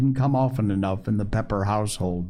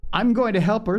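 put-on higher, eager small-child voice; lightly voiced little girl; 8 semitones higher, faster than usual. A narrator's reading. Phronsie announcing she will help, lightly voiced little girl. Little girl's line in story.

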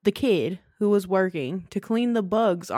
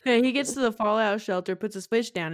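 normal talking voice

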